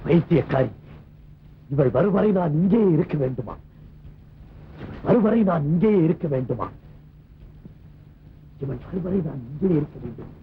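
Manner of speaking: low bitter self-mocking aside; bitter broken man. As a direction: sneer at her, then sink into despair. Blinded man left alone, bitter.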